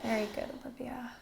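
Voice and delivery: quick hushed whisper to the client. Real, not acted. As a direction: whisper the praise gently. Whispered praise to breathing client.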